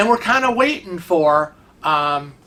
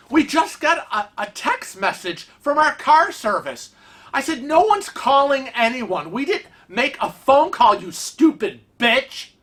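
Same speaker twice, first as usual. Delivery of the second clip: retold outburst; 7 semitones higher than usual. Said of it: "I, I lost it".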